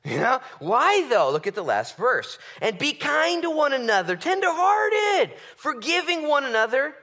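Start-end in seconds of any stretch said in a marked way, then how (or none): 2.6-6.9 soaring preacher's cry; fervent shout. Real, not acted. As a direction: proclaim the verse at full force. Preacher reading verse with fervour.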